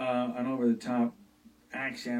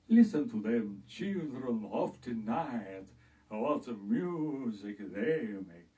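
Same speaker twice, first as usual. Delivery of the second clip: put-on slow Hungarian vampire accent; Bela Lugosi impression; much slower than usual. A lightly voiced Hungarian count savouring the night. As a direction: drag the Hungarian vowels out slowly. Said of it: "Bela Lugosi's accent".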